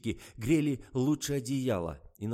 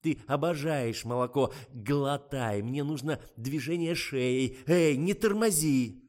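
raised, insistent commands; urging director; 4 semitones higher, 6 dB louder, slower than usual. A narrator's reading. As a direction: drive the actress on, louder at the end.

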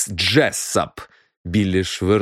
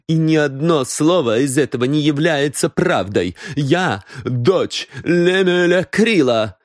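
proud defiance; 7 semitones higher than usual. A narrator's reading. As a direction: stand by your father's name. Daughter defends her claim. (дочь гордо и с вызовом заявляет)

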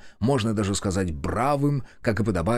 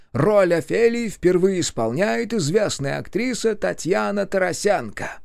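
grand entrance announcement; 8 semitones higher than usual. Acted. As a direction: proclaim it like a theatre host. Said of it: "торжественно объявил Ильич".

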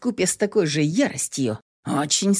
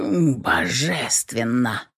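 slow savored; 6 semitones lower, much slower than usual. Acted.

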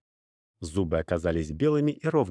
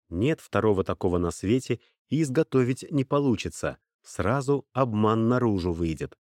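ordinary delivery

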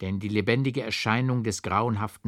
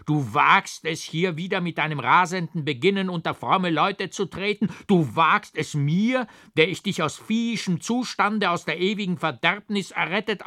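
much higher, louder scolding tirade; furious rebuke; 8 semitones higher, 4 dB louder than usual. A narrator's reading. Forester rages at the monk who attacked him, furious shout. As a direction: thunder at the ungrateful sinner. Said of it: "rief der Förster".

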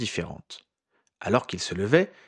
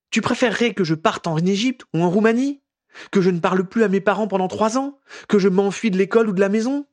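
higher, louder, hurt rhetorical jabs; tearful bitter questions; 10 semitones higher, 5 dB louder than usual. A narrator's reading. Tearful Ginny challenges Harry, higher and louder. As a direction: throw the questions at him, near tears.

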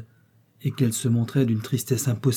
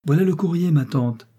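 put-on a boy calling out the mail, higher and louder; lively young call of news; announcing; 6 semitones higher, 4 dB louder than usual. A narrator's reading. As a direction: announce the post across the terrace. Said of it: "annonça Sacha".

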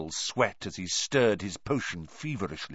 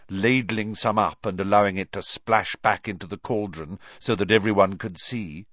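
ordinary delivery, nothing marked

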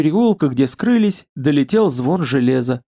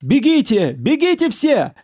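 panicked shout; 10 semitones higher than usual. Acted. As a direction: scream at everyone to run. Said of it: "слышался крик".

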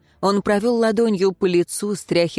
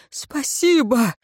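a higher, drawn-out grateful cry; exclaimed thanks; 6 semitones higher, slower than usual. A narrator's reading. The peasant cries out thanks to the advisor, higher and slow. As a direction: thank him with relief. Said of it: "воскликнул Власт".

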